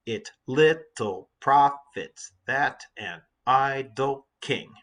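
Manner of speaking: slow iambs, punching LITTLE, PROFITS, THAT, IDLE and KING on the stressed syllables; slow da-DUM beat, "It little profits that an idle king", every second syllable hit; exaggerated iambic rhythm. Shown as a demonstration. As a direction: beat out "it LIT-tle PROF-its THAT an I-dle KING". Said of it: "you can sort of hear it".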